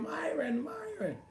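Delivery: high-pitched fangirl squeal of his name; mock screaming fan. Boxer mocking a fan screaming his name, high squeal. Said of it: "ain't nobody gonna be screaming".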